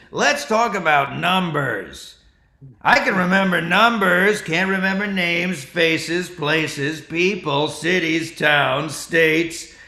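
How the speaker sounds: loud, punchy Carlin list cadence; George Carlin impression. Comic doing his work-in-progress Carlin bit, loud list cadence. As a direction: rattle off the list like Carlin on stage. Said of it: "the first riff of the Carlin bit".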